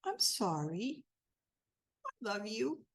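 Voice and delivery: put-on quiet, tender sorry from a little boy; soft child apology. Grandson turning to grandma, a quiet tender apology. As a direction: lightly voice the grandson's sweet sorry. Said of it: "Turns to me and said".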